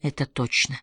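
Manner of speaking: low, soft and downcast; sad agreement. A narrator's reading. Roddy agrees with Elinor about love, sadly. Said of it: "печально согласился Родди".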